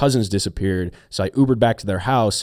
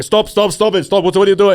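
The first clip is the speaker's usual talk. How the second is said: panicked yelling; 7 semitones higher, 4 dB louder than usual. Retold or imitated. Panicked yelling at Uber driver.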